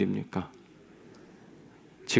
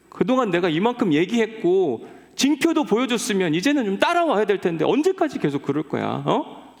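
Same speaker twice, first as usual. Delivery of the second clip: high, loud angry rebuke; angry God; 7 semitones higher, 3 dB louder than usual. Acted. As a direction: let him have it, voice up and loud. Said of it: "화를 먼저 내십니다".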